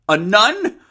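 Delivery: loud, high astonished question; excited cry. A narrator's reading. Mother reacts to daughter's news, loud excited cry. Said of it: "cried her mother excitedly".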